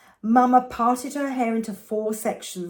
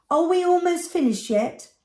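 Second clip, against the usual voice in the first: put-on much higher, whiny kid asking; impatient child; 8 semitones higher, 3 dB louder than usual. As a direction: plead to be done like a little girl.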